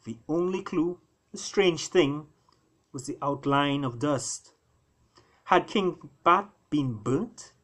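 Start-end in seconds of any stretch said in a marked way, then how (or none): none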